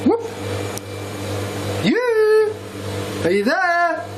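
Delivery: very high mock ghostly call; imitating the voice. Ghost hunter repeats what he heard, high mock call. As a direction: echo the strange voice, pitched far up. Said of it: "It's like it was like".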